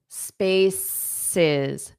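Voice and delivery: "spaces" drawn out as two separated syllables; teacher carefully sounding out "spaces" in two parts; pronunciation demo. Shown as a demonstration. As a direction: model "spaces" slowly, splitting off the final syllable. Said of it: "The correct pronunciation of spaces".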